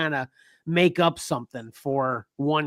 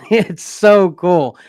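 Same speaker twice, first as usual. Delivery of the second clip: usual speaking voice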